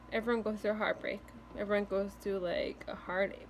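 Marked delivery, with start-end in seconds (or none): none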